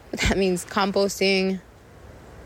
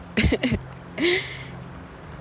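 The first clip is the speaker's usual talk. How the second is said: breathy high laugh; 8 semitones higher than usual. Real, not acted. Laughs at own remark.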